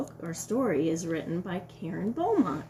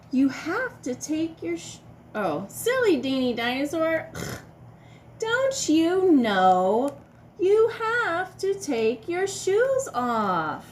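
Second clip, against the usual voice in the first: put-on broad singsong kids theatre; 6 semitones higher, 5 dB louder than usual. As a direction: tease the silly dinosaur with a sigh. Scolding the silly dinosaur.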